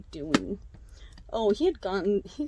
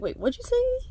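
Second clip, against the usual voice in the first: high-pitched incredulous question, imitating; retold surprise, pitched way up; mock disbelief; 4 dB louder than usual. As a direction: act out a disbelieving double take. Retold boyfriend acting incredulous.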